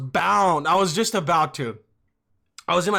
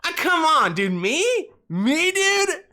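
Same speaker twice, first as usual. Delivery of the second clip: much higher, louder, fake-shocked protest; mock innocent denial; 10 semitones higher, 4 dB louder, slower than usual. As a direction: act outraged that anyone could suspect you. Denying smoking to dad.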